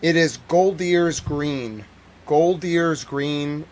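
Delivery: Goldier's Green said slowly, syllable by syllable; careful pronunciation. Shown as a demonstration. To viewers, getting the place name right, slow and careful. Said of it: "let me get the exact pronunciation right".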